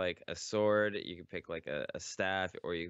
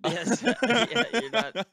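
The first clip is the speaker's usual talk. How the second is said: high, loud burst of laughter; cracking up while answering; laughing; 10 dB louder, slower than usual. Real, not acted. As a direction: laugh the words out, really amused. Laughing at friend's Team Ten joke.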